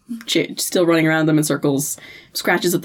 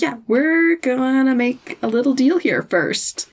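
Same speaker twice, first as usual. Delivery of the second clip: put-on Dean voice; 7 semitones higher than usual.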